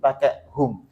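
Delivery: usual speaking voice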